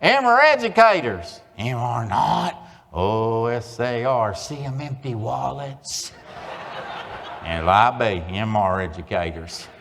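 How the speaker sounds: exaggerated Oklahoma twang; Oklahoma twang reading. Shown as a demonstration. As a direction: drawl it for the educators. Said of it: "Can you read that with me?".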